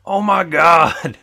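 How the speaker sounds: loud, slow amazed gasp; excited exclamation. Retold or imitated. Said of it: "he goes".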